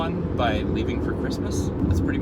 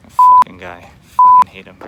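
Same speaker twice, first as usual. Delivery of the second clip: high, loud hateful yell; furious shout; 16 dB louder than usual. Real, not acted. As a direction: let the hate burst out. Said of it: "I'm just kind of fucking raging".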